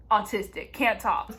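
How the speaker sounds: ordinary delivery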